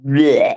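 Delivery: low disgusted bleh. Real, not acted. Woman dismisses their tones with a noise, disgusted bleh. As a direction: blurt a drawn-out noise of disgust.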